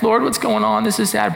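mock whiny plea, pitched high. Shown as a demonstration. Gideon whining to the Lord about what's going on, whiny. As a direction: whine it like a hard-done-by complaint. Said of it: "read his response as kind of whiny".

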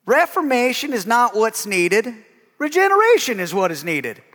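high, slow Southern drawl on "Reformation", rising and drawn out; J. Vernon McGee southern drawl. Retold or imitated. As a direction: keep the old Southern preacher drawl through "Reformation is not what's needed". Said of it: "I love Southern drawl".

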